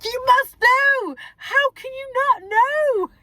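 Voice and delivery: high, loud, slow exasperated mum; exasperated scolding. Retold or imitated. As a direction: stress each word like a cross mum.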